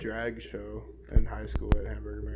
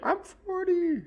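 mock whiny; much slower than usual. Mocking a birthday celebrant.

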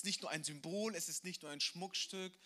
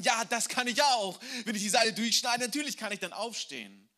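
higher and much louder, mocking obviousness; scoffing retort; 6 semitones higher, 10 dB louder than usual. Drunk man scoffs at the missionary's trick, loud. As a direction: toss it off, of course, loudly.

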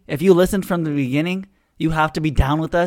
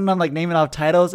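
normal talking voice

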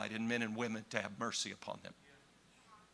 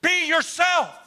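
loud high shout; shouted prayer climax; preaching cry; 12 dB louder, slower than usual. Real, not acted. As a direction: cry it out to God.

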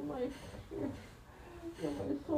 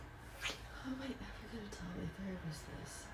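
tearful broken whisper; 6 semitones lower, 13 dB quieter than usual. Acted. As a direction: whisper it, barely holding together. Sobbing whisper.

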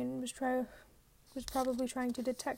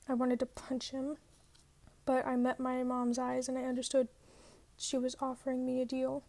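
ordinary delivery, nothing marked

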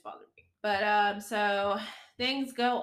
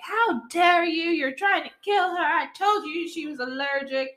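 mock furious mother; 8 semitones higher, 8 dB louder than usual. Mother accusing the nanny, high and loud. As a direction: scold like an outraged mother. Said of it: "Nina, comes out and she's like".

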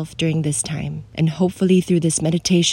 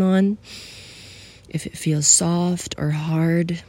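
soothing guide for someone feeling alone; guided meditation. Acted. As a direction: ease the listener into stillness.

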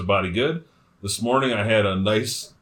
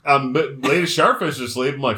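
ordinary delivery

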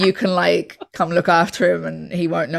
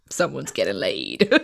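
low, sly innuendo; a lower, knowing tease; 10 semitones lower, 4 dB quieter than usual. Retold or imitated. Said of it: "And it was like".

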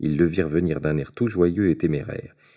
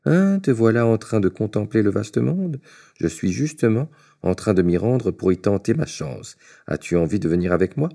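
a bit higher, bright and chummy greeting; lightly voiced cheerful tailor; 5 semitones higher than usual. The little tailor cheerfully hails the giant, lightly higher. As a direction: hail the giant like an old pal.

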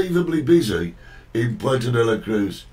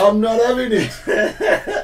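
slow, high, laughing through it; laughing catchphrase; 9 semitones higher, 4 dB louder, slower than usual. Retold or imitated. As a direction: drag the catchphrase out while laughing. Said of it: "a phrase that you use".

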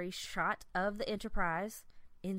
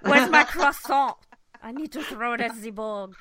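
high, loud hammed-up French accent; 4 semitones higher, 12 dB louder than usual. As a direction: shout it in a silly French accent. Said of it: "French joke bit".

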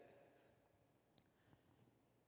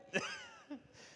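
high burst of laughter; 5 dB quieter than usual. Real, not acted. Laughs at own joke.

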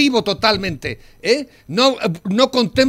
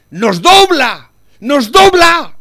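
"nos dobla" slow and loud, then "nos dobla" higher and louder still; angry man driving home "nos dobla", slow and loud then higher; hammered repeat; 6 semitones higher, 9 dB louder, much slower than usual. Real, not acted. Hammering a point in argument.

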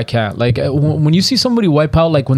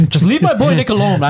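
mock protective voice; 7 semitones higher than usual. Mock defending friend.

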